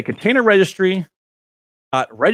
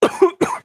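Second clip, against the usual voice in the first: choking cough. Real, not acted. Choking on a sip of water.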